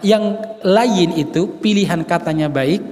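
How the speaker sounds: usual speaking voice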